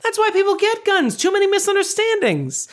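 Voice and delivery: high, loud put-on Brody voice; lightly hammed Brody quote; Brody impression. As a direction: pitch way up and push it out like a deadpan gunman. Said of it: "He's like".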